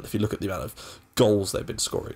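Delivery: ordinary talk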